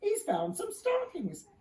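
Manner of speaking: high, slow excited announcement; excited call. A narrator's reading. Steeples calling to the houses, high and excited. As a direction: call the news out, thrilled. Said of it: "called to the houses".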